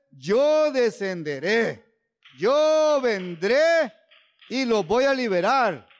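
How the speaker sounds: drawn-out, swelling words of God's promise; slow emphatic promise. Acted. Preacher voicing God's promise, slow and emphatic. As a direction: stretch each word like a divine vow.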